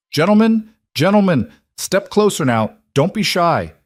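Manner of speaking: high, loud auctioneer calling in a crowd; sharp, greasy auctioneer. A narrator's reading. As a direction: crack it out like a slave trader working the square. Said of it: "the auctioneer's voice crack across the square".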